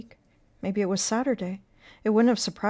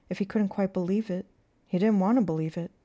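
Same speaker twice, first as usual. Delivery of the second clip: usual speaking voice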